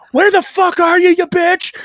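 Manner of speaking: screaming profanity at full blast; enraged shout at the wife; furious yell. Retold or imitated. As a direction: bellow it down the stairs in a rage. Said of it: "now all the profanity's coming out".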